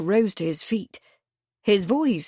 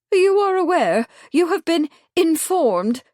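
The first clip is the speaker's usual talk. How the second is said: higher, excited busybody voice; fussy village lady; 10 semitones higher, 5 dB louder than usual. A narrator's reading. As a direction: gush with self-important urgency. Truebody affronted.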